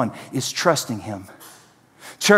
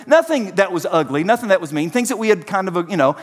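usual speaking voice

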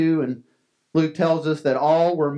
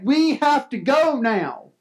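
higher, slow, pleading to leave now; a father pleading, higher and slower; urgent plea; 10 semitones higher, slower than usual. Acted. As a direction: beg him to come, pitched up and drawn out. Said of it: "Jairus here saying".

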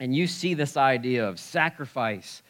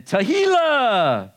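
high, long, loud Spanish-accented flourish; 11 semitones higher, 8 dB louder, much slower than usual. Shown as a demonstration. Preacher introducing a Hebrew praise word, loud drawn-out Spanish accent.

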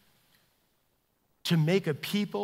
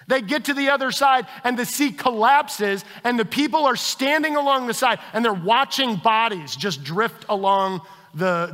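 louder, fervent sermon delivery; full-force preaching; 6 semitones higher, 8 dB louder than usual. Acted. Pastor retelling the Red Sea to his congregation, fervent preaching. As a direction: proclaim God's power to the room.

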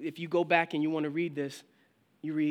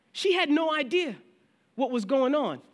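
higher, louder amazement; excited; 9 semitones higher, 6 dB louder than usual. Retold or imitated. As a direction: marvel at what God revealed. Pastor recalls revealing prophecy.

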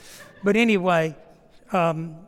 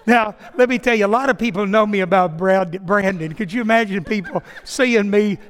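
laughing through words; 3 semitones higher, 10 dB louder than usual. Real, not acted. Preacher telling the congregation about the dumpster, laughing loudly. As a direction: push the words out while laughing.

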